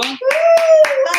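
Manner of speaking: high, loud "woo"; joyful whoop. Real, not acted. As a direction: whoop in praise after the song. Whoop after worship song. (cri de joie aigu)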